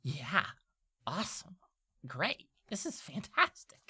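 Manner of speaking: slow, halting imp with a high finish; small creature's stilted enthusiasm; Diplin agreeing eagerly. Acted. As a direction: force out delight, word by word. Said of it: "Diplin's like".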